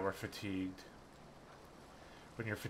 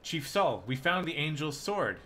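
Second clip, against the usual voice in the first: loud, raised, eager announcement; urgent plea; 6 semitones higher, 5 dB louder than usual. Acted. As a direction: burst in with the big news.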